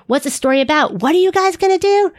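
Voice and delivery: high, bright questioning teacher voice, rising toward the end; teacher asking the group, voiced high and lively; imitating the teacher. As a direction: play the classroom teacher quizzing the kids. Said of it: "when your teacher says".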